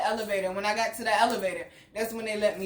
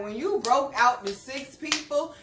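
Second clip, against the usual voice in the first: ordinary talk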